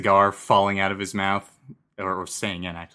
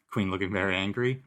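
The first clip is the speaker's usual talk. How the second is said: normal talking voice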